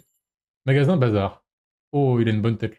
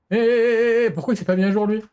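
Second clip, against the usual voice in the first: annoyed; 8 semitones higher than usual. Real, not acted. Annoyed game not updating.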